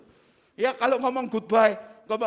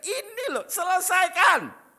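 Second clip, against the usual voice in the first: commanding shout; 9 semitones higher, 5 dB louder, slower than usual. Retold or imitated.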